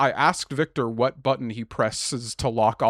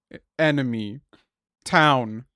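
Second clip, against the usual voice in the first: ordinary delivery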